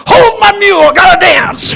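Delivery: high, loud whoop of pure joy; joyous shout. Retold or imitated. Old farmer leaving his mule to dance, ecstatic loud shout. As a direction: cry it out, too happy to hold still.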